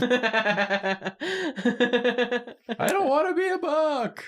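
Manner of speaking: very high, distant whimper; high whiny voice. Acted. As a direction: whine like a cartoon victim. Voicing the drawn character.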